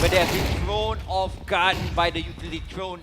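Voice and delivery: loud, high play-by-play call; esports caster. Acted. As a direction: call the push with rising hype. Live play-by-play of round.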